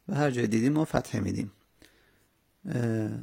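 ordinary delivery

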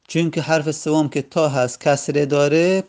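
ordinary delivery

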